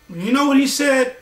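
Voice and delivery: ordinary talk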